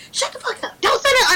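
very high mock scolding; imitated angry mom. Mom silencing her child, imitated angry snap. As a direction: cut the kid off in a high, cross voice. Mom snapping back in retold quarrel.

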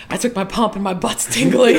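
giggling through a quoted customer; laughing quote. Retold or imitated. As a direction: laugh while quoting a gym client. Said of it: "people will walk into my class and be like".

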